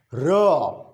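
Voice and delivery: R as "ruh", not the letter name; isolated "ruh" for R; voiced consonant demo. Shown as a demonstration. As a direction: sound out R with a short vowel.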